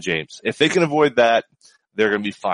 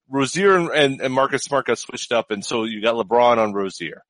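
usual speaking voice

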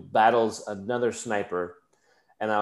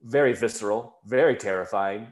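ordinary talk

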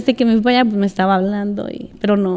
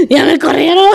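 mock wail; 5 semitones higher, 3 dB louder than usual. Calling wife after being fired.

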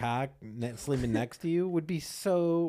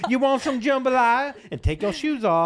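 high loud laughing southern mom; southern accent; 11 semitones higher, 8 dB louder than usual. Pretend. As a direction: offer jambalaya in a southern accent. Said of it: "a southern accent".

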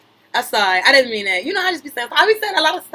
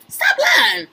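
much higher, shouting; shouted accusation; 12 semitones higher than usual. Real, not acted. Calling out a lie.

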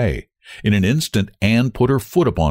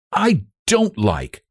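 slow, high, intense protest; quiet intensity; 9 semitones higher, slower than usual. A narrator's reading. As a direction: say it slowly with contained intensity. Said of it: "said Frances with quiet intensity".

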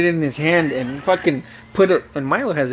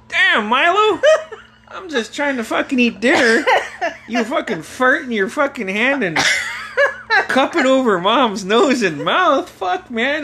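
high, aggrieved scolding of a brother; reenacted exasperated complaint; 11 semitones higher than usual. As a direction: complain to your brother, pitched up. Narrator scolds brother at dinner.

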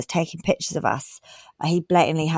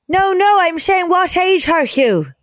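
high, slower Irish pharmacist; Irish accent; 10 semitones higher than usual. Retold or imitated. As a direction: lean hard into Irish vowels. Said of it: "in an Irish accent".

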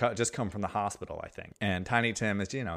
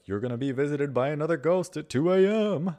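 higher, louder mock heckler voice; old Muppet hecklers; 7 semitones higher, 6 dB louder than usual. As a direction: ham it up like two grumpy old puppets. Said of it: "Statler and Waldorf are like".

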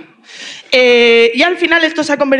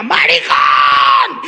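long, drawn-out mock yell; re-enacted shout; 4 semitones lower, much slower than usual. Re-enacting own flour-covered Elmo bit, long drawn-out shout. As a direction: stretch the word out like a battle cry. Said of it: "me levantaba con la cara llena de harina y decía".

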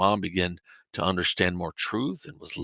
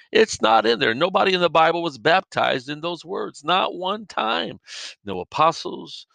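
fiery preaching; 5 semitones higher than usual. Real, not acted. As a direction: drive the point home from the pulpit. Preaching at full force.

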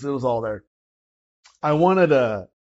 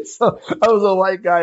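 laughing; 6 semitones higher, 7 dB louder than usual. Real, not acted. Laughing at his confused past.

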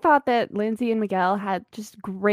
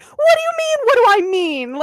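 high, loud squeaky mock panic; quoting a funny TV scene in a shrill mock voice; imitating Miguel; 13 semitones higher, 8 dB louder, faster than usual. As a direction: squeal the question back in disbelief. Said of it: "the way Miguel is like".

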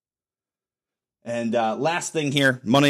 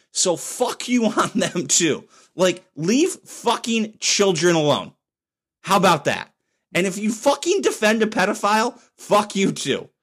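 heated, higher swearing at them; angry rant; 6 semitones higher than usual. Real, not acted. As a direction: spit out the curses in real disgust. Angry rant about child groomers.

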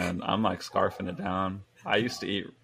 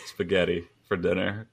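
usual speaking voice

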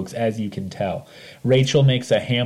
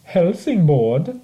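drawn-out, higher German-sounding place name; exaggerated Germanic lilt, pitched up; mock German accent; 6 semitones higher, much slower than usual. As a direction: say it slowly in a playful German accent. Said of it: "perhaps more German".

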